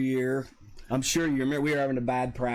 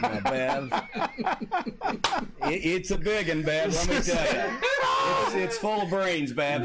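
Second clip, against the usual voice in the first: laughing banter; 5 semitones higher than usual. Real, not acted. Joking reply to teasing comment.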